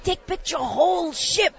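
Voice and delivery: a light put-on accent of a fussy photographer; imitating Japanese accent. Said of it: "She goes".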